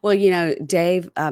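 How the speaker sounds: ordinary talk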